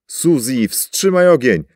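high, loud order; urgent ceasefire yell; commanding shout. A narrator's reading. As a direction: bark the command over the chaos. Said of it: "rozkazał Ian".

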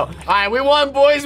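ordinary delivery

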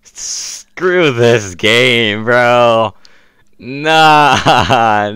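drawn-out. Real, not acted. Slow drawn-out complaint.

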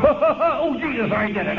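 high, breaking into real laughter; laughing despite himself. Retold or imitated. Recalling his first laugh in a meeting, laughing. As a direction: let the laugh spill through the words.

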